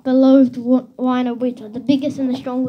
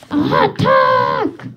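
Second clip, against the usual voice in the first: villain's command; 8 semitones higher than usual. A narrator's reading. Villain orders robot animals.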